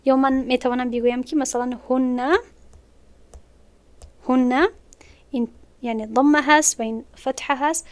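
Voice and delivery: normal talking voice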